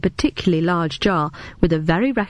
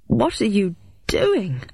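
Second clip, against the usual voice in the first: revolted; 3 semitones higher, slower than usual. A narrator's reading. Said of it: "the revulsion rising in my throat".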